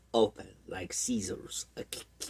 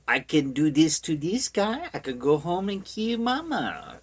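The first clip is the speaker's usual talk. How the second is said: higher voice with a hint of French; lightly French-accented character voice; accidental French accent; 6 semitones higher than usual. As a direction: slip into a mock French accent, pitched up. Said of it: "I don't know why he's French now".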